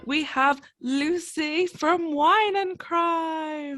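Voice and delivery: high, slow grand announcement; announcer voice. Acted. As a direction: present the guest like a showman. Announcing the guest.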